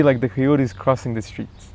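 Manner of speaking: ordinary delivery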